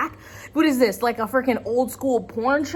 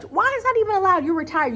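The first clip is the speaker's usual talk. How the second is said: high, fast disbelief; incredulous outburst; exasperated; 9 semitones higher, faster than usual. Real, not acted. Outraged rhetorical question.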